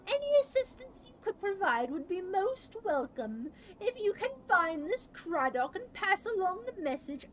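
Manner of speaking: high-pitched overjoyed messenger. Acted. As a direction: squeal with delight at good fortune. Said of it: "Bayan's face lights up like a lantern".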